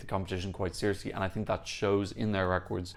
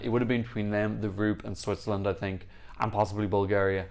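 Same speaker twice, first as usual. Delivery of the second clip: normal talking voice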